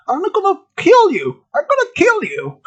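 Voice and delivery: high-pitched crazed threat; hammed-up high scream; screaming maniac clown. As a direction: taunt them like a killer clown, voice way up. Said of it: "screaming and laughing".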